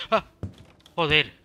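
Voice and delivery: sudden loud fright cry; startled scream. Real, not acted. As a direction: yelp then loud curse. Jump scare in horror game.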